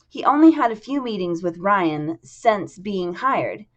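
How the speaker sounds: plain reading voice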